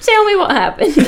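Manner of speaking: laughing confused mom. Retold or imitated. Amused friend playing the baffled mother, high laughing. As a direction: ask through laughter in a high voice.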